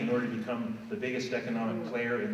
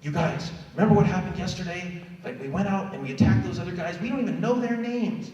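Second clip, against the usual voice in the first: high, excited pawn rallying the others; a fired-up pawn pleading with the others to stop; rebel pawn; 6 semitones higher than usual. Retold or imitated. Rebel pawn urging the other pawns to quit the war game, high and excited. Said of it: "They're like".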